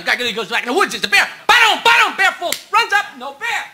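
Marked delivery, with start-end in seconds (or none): 1.5-2.2 imitated heartbeat.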